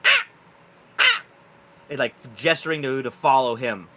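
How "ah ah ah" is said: short, high raccoon yips; raccoon calls. Acted. Game master as raccoon beckoning August, short yips. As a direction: yip like a beckoning little animal. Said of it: "looks to you and he goes".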